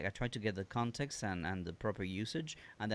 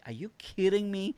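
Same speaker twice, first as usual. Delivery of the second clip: incredulous outburst; 11 semitones higher, 7 dB louder than usual. Retold or imitated. Exasperated at car AI.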